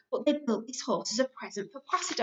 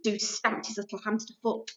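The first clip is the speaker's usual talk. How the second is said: ordinary delivery, nothing marked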